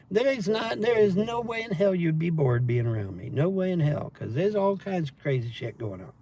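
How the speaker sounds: ordinary talk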